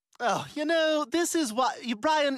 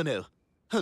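ordinary talk, nothing marked

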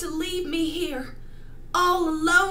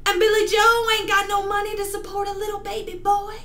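higher, louder, fast outburst; tragic heroine sorrow; 6 semitones higher, 8 dB louder, faster than usual. Acted. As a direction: blurt it out in despair. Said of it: "performing a monologue for you".

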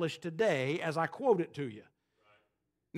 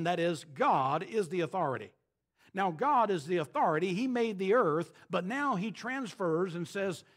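ordinary talk, nothing marked

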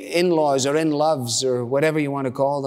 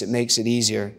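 usual speaking voice

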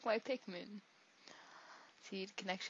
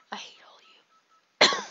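mock whisper; 12 dB louder than usual. Gamer joking to viewers, mock whisper. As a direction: whisper it as a tease.

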